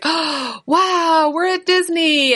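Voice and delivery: put-on high, wide-eyed child's delight; awestruck little girl. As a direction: gasp in wonder at the fireworks. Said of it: "she was like".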